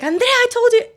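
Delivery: very high, loud mock yell; imitated angry mom. Mom at the bathroom door, imitated angry yell. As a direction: shout it like a fed-up mother. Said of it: "this time she is not happy".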